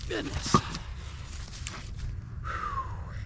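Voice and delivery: panting between words; winded from swinging the maul; out of breath. Real, not acted. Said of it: "Out of shape".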